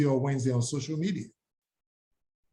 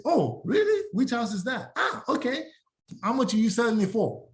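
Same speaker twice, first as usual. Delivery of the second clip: higher, surprised and keen neighbor asking questions; curious neighbor; 6 semitones higher than usual. Retold or imitated. Neighbor surprised by the realtor's visit, eager and higher. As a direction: perk up like a neighbor hooked by the news. Neighbor asks about the open house.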